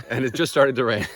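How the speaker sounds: laughing while speaking, high. Real, not acted. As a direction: giggly laugh at the rain. Laughing at sudden rain.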